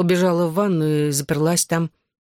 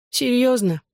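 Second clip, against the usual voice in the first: high whiny disbelief; whining; 7 semitones higher, slower than usual. A narrator's reading. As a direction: whine the question. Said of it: "заскулила она". (скулящим голосом)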